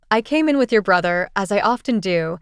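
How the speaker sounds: plain reading voice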